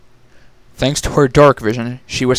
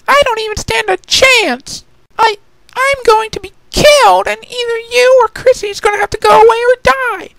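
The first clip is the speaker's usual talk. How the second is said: much higher, small pleading filly voice; beg, close to tears, tiny voice; frightened little sister; 5 dB louder than usual. Acted. Youngest sister begging not to be sent alone, much higher and pleading.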